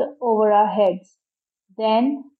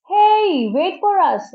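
high, drawn-out calling yell; yelled plea; 9 semitones higher, 3 dB louder, slower than usual. A narrator's reading. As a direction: call after a departing boat. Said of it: "I yelled".

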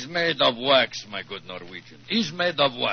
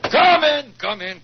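booming call to enter; loud welcome; 10 semitones higher, 9 dB louder than usual. Acted. Russian envoy loudly welcoming visitors into his cell. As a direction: bellow a hearty greeting.

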